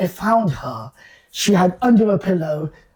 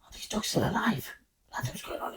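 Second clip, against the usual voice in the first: whispered aside, unvoiced; 3 semitones higher, 16 dB quieter than usual. Man hearing the dog on the phone, whispering. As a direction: breathe it as a secret.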